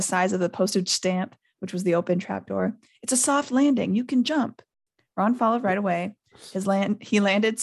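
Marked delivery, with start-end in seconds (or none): 3.0-4.6 calling up. A narrator's reading. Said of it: "he called up to the light".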